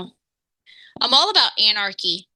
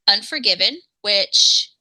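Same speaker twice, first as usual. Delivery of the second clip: ordinary talk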